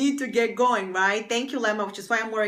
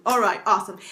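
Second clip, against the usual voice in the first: normal talking voice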